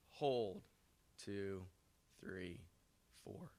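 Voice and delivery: slow, soft counting of breaths; soft spa whisper. Shown as a demonstration. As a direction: guide the breathing gently, fading away. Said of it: "Take them through a box breathing".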